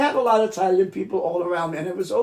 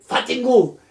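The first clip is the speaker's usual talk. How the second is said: higher, louder mimicked bird curse; cursing mynah bird; 5 semitones higher, 6 dB louder than usual. Telling how the aunt's mynah swore, squawked. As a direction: blurt the curse like a talking bird. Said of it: "and then he would say".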